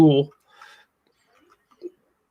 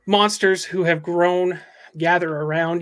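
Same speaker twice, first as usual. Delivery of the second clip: ordinary delivery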